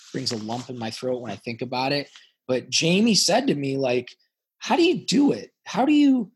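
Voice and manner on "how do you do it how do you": high, astonished, retelling a coworker's disbelief; amazed question. Retold or imitated. CFO asking how he yells at billionaires, high amazed voice. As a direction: ask it like you can't believe anyone could do that.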